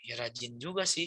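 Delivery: low, softened grudging reply; mock sheepish admission. Believer admitting he works hard too, acted sheepishness. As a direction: concede it reluctantly, playing it up.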